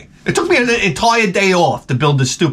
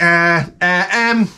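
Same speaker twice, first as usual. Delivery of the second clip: hesitant higher mock English accent; 5 semitones higher than usual. Beatles awkwardly tell [name] to leave, English accent. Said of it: "that's my English accent".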